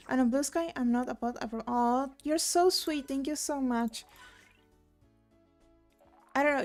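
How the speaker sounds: usual speaking voice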